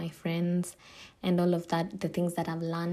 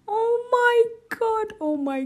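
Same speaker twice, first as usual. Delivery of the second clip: excited squeal; 4 dB louder than usual. Real, not acted. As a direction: squeal with delight at the song. Squealing over love song intro.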